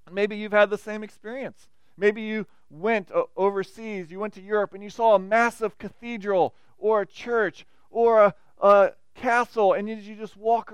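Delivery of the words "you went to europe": normal talking voice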